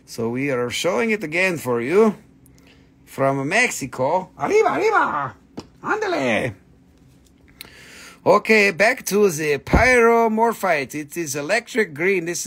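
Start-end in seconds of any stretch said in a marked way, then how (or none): 4.4-6.6 high, whooping arriba cry; mock Mexican cheer. Seller hypes a stone from Mexico, mock Mexican cheer. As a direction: whoop it in a playful put-on Mexican style.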